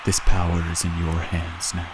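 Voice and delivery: deep villain voice. Acted. Voicing Skanky Pete, deep villain.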